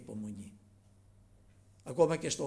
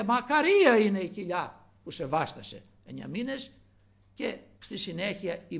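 loud, higher-pitched cry of blessing; raised voice; 5 semitones higher, 9 dB louder than usual. Retold or imitated. Woman calling to Jesus from the crowd, raised voice. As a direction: proclaim it loudly over a crowd. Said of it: "ύψωσε, λέει, την φωνή αυτής".

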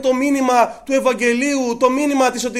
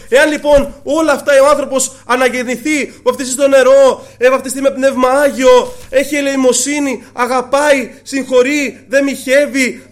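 loud, fervent sermon voice for the Lord's promise; preaching the Lord's words; 3 semitones higher, 8 dB louder than usual. Acted. Preacher voicing the Lord to the congregation, louder and fervent. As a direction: proclaim it to the whole church.

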